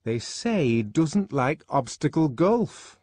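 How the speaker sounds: high, slow, loud woman's voice; scandalized gossip. A narrator's reading. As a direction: pass on the rumour with weight. Fanny passes on scandalous gossip.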